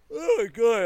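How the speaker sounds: apologetic, yawning. Real, not acted. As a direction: talk through a yawn. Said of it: "I keep yawning".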